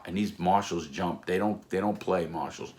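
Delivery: plain reading voice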